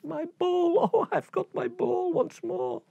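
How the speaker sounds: high, giggling delight; high girlish laugh of joy; high happy princess laughing. Acted. Princess skipping happily.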